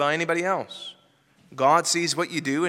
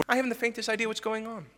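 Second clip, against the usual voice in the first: mock clueless aside; 4 semitones higher, 7 dB quieter, faster than usual. Skit fan admits ignorance.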